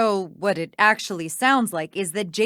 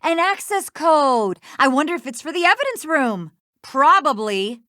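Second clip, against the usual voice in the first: raised, higher-pitched pointed mockery; loud sarcastic accusation; 4 semitones higher, 4 dB louder than usual. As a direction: throw it at them, loud and scornful. Sarcastically shows code left on wall.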